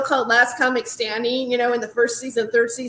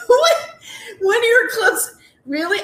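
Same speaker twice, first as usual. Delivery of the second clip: incredulous; 8 semitones higher than usual. Retold or imitated. Incredulous high retort.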